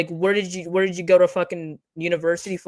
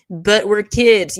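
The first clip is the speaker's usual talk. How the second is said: mocking whine; 3 semitones higher, 7 dB louder, much slower than usual. Mocking the other side's whine.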